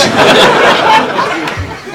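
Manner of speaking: loud breathless burst of laughing; real laughter. Real, not acted. Storyteller laughing at the climax, loud breathless laugh. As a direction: let the laugh overtake you.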